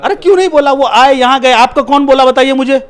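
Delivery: real heated protest, loud and high throughout; indignant outburst. Real, not acted. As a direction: fire questions back at the top of your voice. Angry retort to interviewer's accusation.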